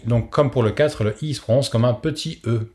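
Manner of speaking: ordinary talk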